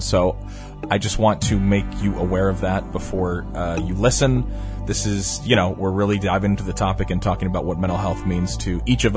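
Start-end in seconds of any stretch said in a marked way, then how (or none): none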